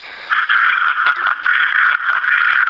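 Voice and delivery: real laugh, breathy and unvoiced. Real, not acted. Player teased by host about scoring six goals, laughing. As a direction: laugh off the teasing.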